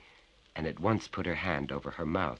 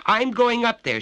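high, loud urgent cry; trembling cry; 10 dB louder than usual. Acted. Betty declares she'll go upstairs, high urgent cry. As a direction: blurt it out, shaken. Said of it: "she cried, trembling".

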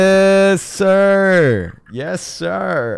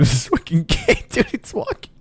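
usual speaking voice

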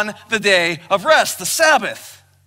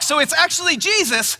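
ordinary talk

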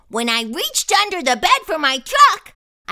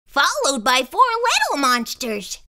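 usual speaking voice

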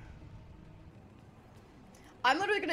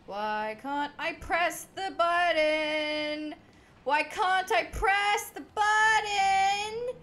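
slow, rising, louder whine; exasperated whine; 5 semitones higher, 4 dB louder, much slower than usual. Real, not acted. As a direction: drag it out in growing frustration. Repeated frustrated question.

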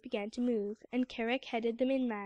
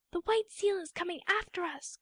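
alarmed cry; 7 semitones higher than usual. Acted. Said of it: "cried Patalamon".

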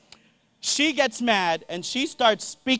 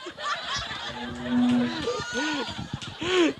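laugh; 3 semitones higher, 6 dB quieter than usual. Real, not acted. Laughing at his own joke.

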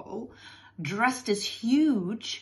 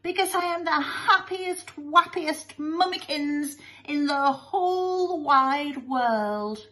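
high, drawn-out sentimental mummy voice; gushing mother; 7 semitones higher, slower than usual. Acted. Weeping mother answers her son, hammed-up gushing. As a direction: gush with overblown happiness, slowly.

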